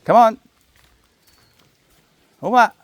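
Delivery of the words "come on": ordinary delivery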